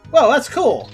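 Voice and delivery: normal talking voice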